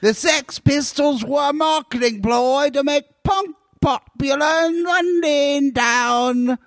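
high, drawn-out mock London accent; hammed-up London accent. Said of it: "The worst fucking accent".

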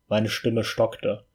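usual speaking voice

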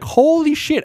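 shrieked high in mock disbelief; mock amazement. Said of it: "And you're like".

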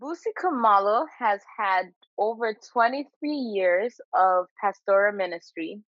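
ordinary talk

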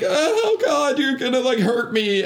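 high, fearful pleading, acted out; mock frightened plea. Imitating the woman he grabbed, mock fright. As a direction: plead like a scared victim.

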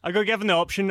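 ordinary talk, nothing marked

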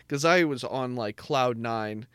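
ordinary delivery

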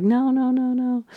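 high, drawn-out mock cry of dismay; retold upset protest. Christelle protesting as Lizzie is sent home, retold in a high upset voice. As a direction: imitate a friend's upset pleading, pitched high. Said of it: "the two of them are crying together".

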